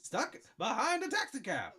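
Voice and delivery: high, nasal-free New York sitcom whine; loud high New York comedian voice; Seinfeld voice. Pretend. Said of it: "a little less Seinfeldy".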